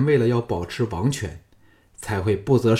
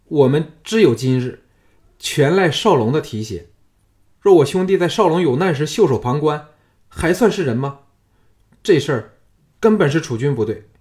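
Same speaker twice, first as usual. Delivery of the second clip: loud, raised, impassioned resolve; resolute; 5 semitones higher, 6 dB louder than usual. A narrator's reading. Changping taking his friend's side against his ruler, resolute. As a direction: declare it firmly, pitched up and forceful. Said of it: "毅然说".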